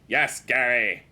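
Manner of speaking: forceful loud reply. Acted. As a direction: push it out loud, as if he didn't hear. Repeats reply to Gary, escalating.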